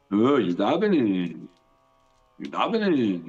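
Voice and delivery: low, drawn-out mocking echo. Shown as a demonstration. Student mocking the speaker by repeating his words, mocking. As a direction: repeat it slowly to make fun of them. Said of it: "say it again in a mocking way".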